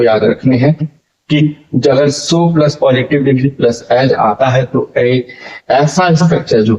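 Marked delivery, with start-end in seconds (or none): none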